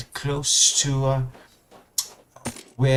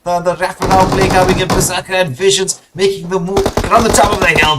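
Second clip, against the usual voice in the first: raised, loud esports commentary; excited play-by-play; 7 semitones higher, 9 dB louder than usual. Real, not acted. Caster follows Chaos raining bullets from the hill, excited. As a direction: call the firefight at full volume, pitched up.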